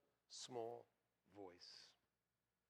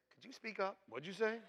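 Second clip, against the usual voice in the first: raised, higher questioning; joking, louder double-take; mock hard of hearing; 8 semitones higher, 8 dB louder than usual. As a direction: call back as if you missed it. Jokingly answering the little voice.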